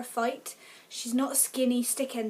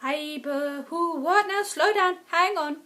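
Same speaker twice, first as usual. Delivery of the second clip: high, loud flustered protest; a brain begging the story to slow down; mock panic at too many plot twists; overwhelmed confusion; 6 semitones higher, 7 dB louder, slower than usual. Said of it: "your brain's just going".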